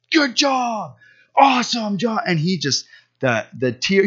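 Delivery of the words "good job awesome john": high, loud-edged whoop of praise; excited cheer. Retold or imitated. Father cheering his son at the top, excited cheer. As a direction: cheer him on, pitched right up.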